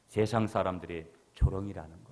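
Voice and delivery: ordinary talk, nothing marked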